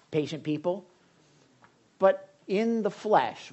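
ordinary talk, nothing marked

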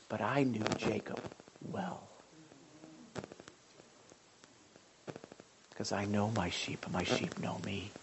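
soft, low, sinking to a whisper; hushed imagined thought. Retold or imitated. Preacher voicing Jesus to the woman, fading to a whisper. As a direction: let it fall to a whisper.

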